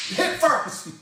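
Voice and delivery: ordinary delivery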